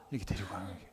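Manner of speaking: ordinary talk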